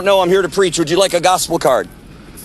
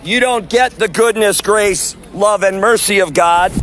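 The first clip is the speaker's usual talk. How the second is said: street sermon; 4 semitones higher than usual. Real, not acted. Street preacher warning the crowd outside the event, preaching. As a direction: call the passers-by to repent.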